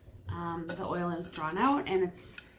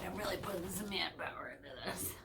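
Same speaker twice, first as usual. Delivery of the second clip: quick breathy whisper while straining; hushed and fast, under the effort; 8 dB quieter, faster than usual. Real, not acted. Vlogger whispering while she cuts the fruit. Cutting the hedge ball, whispered.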